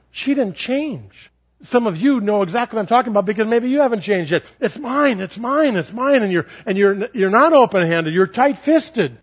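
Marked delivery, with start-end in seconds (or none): 4.6-6.2 higher, petulant mock child; a hint of a greedy kid; childish mine. The preacher mocking selfish listeners as a whiny child.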